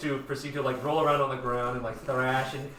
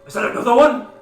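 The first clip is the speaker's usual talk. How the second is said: loud, raised-pitch question; alarmed shout; 9 semitones higher, 12 dB louder than usual. Acted. Sailor spotting another infested man, alarmed shout. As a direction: call it out in alarm.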